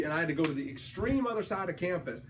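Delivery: ordinary talk, nothing marked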